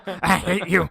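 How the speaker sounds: hammed-up furious yell, loud and building; mock angry screaming. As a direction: scream it like an enraged cartoon robot. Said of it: "just screaming".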